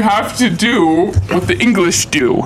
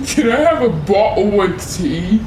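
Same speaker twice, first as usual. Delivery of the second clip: laughing mock British; 3 semitones higher than usual. Mock English request laughing.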